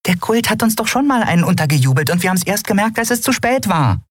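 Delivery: very high, fast, nervous little creature; tiny fluttering creature. Acted. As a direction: chatter like an excited tiny winged pet. Nelen flatterte aufgeregt. (sehr hohe, schnelle, aufgeregte Stimme eines kleinen Flugwesens)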